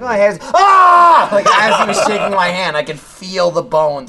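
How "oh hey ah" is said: cheerful hello snapping into a loud high yelp of pain; greeting into a yelp. Retold or imitated. Retelling a painful handshake, hello turning into a loud high yelp. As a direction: greet him, then yelp as the handshake hurts. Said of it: "I'm like".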